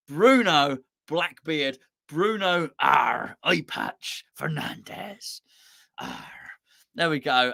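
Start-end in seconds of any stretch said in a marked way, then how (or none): 0.1-6.1 slow, drawn-out pirate growl. Acted. Host gives a footballer a pirate name, slow pirate voice. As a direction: relish each word like a pirate.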